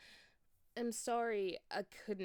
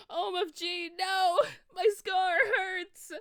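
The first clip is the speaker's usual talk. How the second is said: much higher, very loud pained scream; 13 semitones higher, 14 dB louder, slower than usual. Acted. Boy with burning scar, high loud pained scream. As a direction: cry out in agony, slowly. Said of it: "he started to scream".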